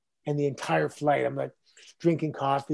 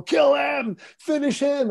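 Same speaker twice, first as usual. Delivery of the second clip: crowd yelling; 11 semitones higher, slower than usual. Retold or imitated. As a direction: yell it like an arena baying for blood. Bloodthirsty crowd calling for his death.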